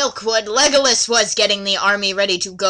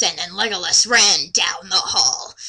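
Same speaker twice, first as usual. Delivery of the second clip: usual speaking voice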